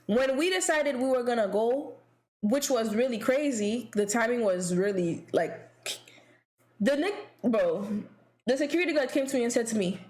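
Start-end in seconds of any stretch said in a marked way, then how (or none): none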